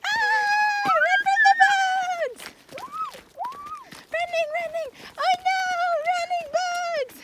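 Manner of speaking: very high, loud mock cries; playful panic. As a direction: squeal it like a fake scare. Pretend fleeing bugs, very high.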